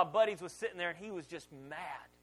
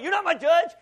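much higher and louder, hotly defensive; indignant outburst; 10 semitones higher, 13 dB louder than usual. Retold or imitated. Pastor retorting to his wife, loud and indignant. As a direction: fire it back at her, wounded pride. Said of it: "I wanna be like".

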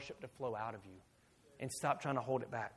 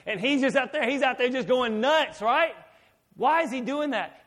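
loud, raised preacher's voice; high-energy, loud sermon delivery; full-force preaching; 8 semitones higher, 9 dB louder than usual. Real, not acted. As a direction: preach it out to the whole room.

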